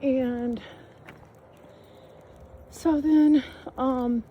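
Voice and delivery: ordinary delivery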